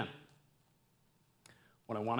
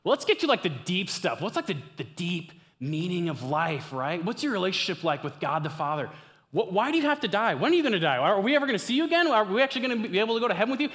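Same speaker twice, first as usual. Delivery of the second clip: high, loud, agitated pleading; urgent questioning; 7 semitones higher, 6 dB louder, faster than usual. Acted. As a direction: pepper him with pressing questions, pitched way up. Imagined urgent questions to Jesus.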